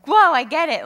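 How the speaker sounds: loud, high burst of realization; excited epiphany. Acted. Reader suddenly understands a Bible passage, excited. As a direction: exclaim it as if it just clicked.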